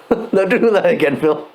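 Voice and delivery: high, laughing repeat of the scold; giggling mock scolding; laughing through it. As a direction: say it again cracking up with laughter. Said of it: "the guys would be like".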